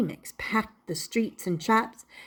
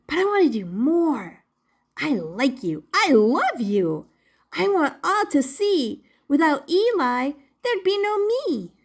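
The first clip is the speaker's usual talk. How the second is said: much higher, quick excited puppet; excited Punchinello; 4 semitones higher, 3 dB louder than usual. Acted. Puppet unsure but eager to surprise his maker. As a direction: rush it out, bubbling.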